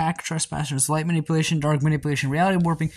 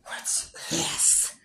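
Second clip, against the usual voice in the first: startled whisper, no voice; 8 dB quieter than usual. Acted. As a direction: breathe the words out. Possessed witch speaks, unvoiced.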